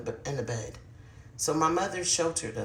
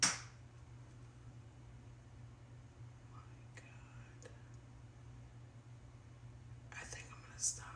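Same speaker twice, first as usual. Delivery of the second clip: real unvoiced whisper, shaken; frightened whisper; 18 dB quieter than usual. Real, not acted. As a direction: breathe the words, spooked. Frightened by noises while filming.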